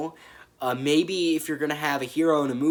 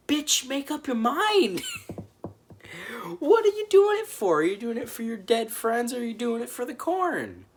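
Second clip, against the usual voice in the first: much higher, louder, incredulous rant; high-pitched exasperated yelling at the character; 12 semitones higher, 4 dB louder than usual. Real, not acted. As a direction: squeal your disbelief at her.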